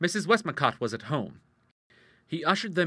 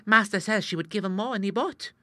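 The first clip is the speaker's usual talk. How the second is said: put-on confidential page boy; 10 semitones higher than usual. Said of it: "in a confidential whisper".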